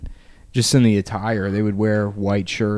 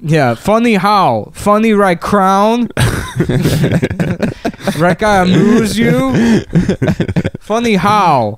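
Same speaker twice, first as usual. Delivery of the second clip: put-on higher, louder, slow mob accent; menacing Goodfellas mobster, slow; mobster Italian accent; 8 semitones higher, 4 dB louder, slower than usual. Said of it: "What a great accent".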